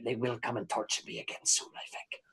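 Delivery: drops to a soft, fearful hush. Acted. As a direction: let it sink to a scared near-whisper. Fearful aside about torture.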